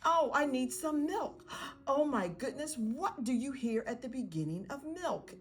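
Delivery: high, animated parent voice; parent prompting child. Acted. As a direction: act delightedly curious for a young child.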